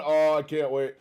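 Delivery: ordinary talk, nothing marked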